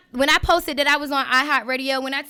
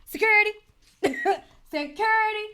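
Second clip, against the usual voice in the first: mock diva call; 9 semitones higher, faster than usual. Mock calling for security.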